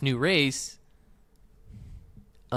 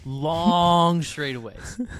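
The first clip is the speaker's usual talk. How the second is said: drawn-out mock echo; 4 semitones higher, 4 dB louder, much slower than usual. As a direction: stretch it out slowly while laughing. Teasing echo of partner's phrase.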